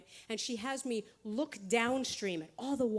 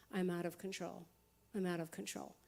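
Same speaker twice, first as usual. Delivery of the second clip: quiet realization; 4 semitones lower, 7 dB quieter than usual. Retold or imitated. Quiet realization after panic.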